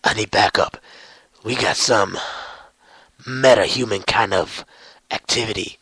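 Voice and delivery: low Batman reporting in; Batman calling backup. Retold or imitated. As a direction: bark it low into a comm. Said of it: "he calls the Justice League".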